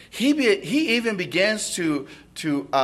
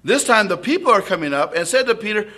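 usual speaking voice

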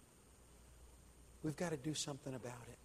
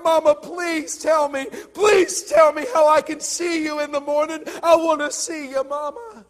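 begging shout; 14 semitones higher, 20 dB louder than usual. A narrator's reading. Son begs dying mother.

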